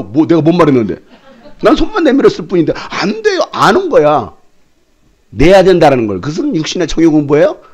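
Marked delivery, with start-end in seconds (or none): 2.7-3.4 mimicked student. Student refusing, squeaky.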